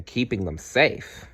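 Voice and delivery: ordinary delivery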